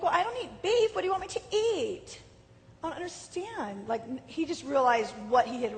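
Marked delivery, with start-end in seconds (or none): 0.0-2.2 high, far-off mock diva whine. A diva wife to her husband, higher and louder. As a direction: play the spoiled diva protesting to her husband. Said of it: "I was a diva".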